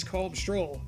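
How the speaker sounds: ordinary delivery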